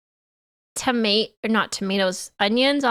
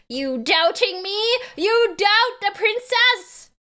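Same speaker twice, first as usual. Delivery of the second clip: slow, high, drawn-out mock indignation; mock-offended princess; 8 semitones higher, slower than usual. Streamer princess to doubting chat, mock-offended. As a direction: challenge them like an affronted princess, hammed-up.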